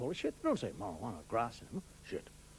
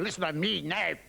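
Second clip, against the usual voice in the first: belligerent Scottish pal talk, louder on each "pal"; threatening Scot; 3 dB louder, slower than usual. Acted. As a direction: menace someone in broad Scots. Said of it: "Scots are always doing that".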